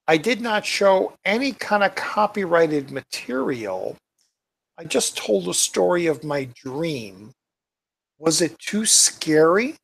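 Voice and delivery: normal talking voice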